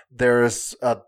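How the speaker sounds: ordinary delivery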